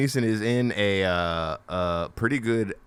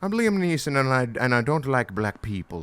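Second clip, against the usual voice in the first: high-pitched Irish caricature of an actor; hammed-up Irish accent; mock Irish accent; 4 semitones higher than usual. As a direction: put on a broad, silly Irish brogue. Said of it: "I wish I could do Irish".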